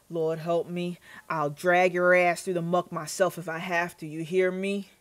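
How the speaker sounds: threatening Southern growl of a speech, forceful; Southern accent. Acted. Landowner threatens over suspected sabotage, menacing Southern accent. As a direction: lay down a threat in a Southern twang. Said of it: "when I put in that accent".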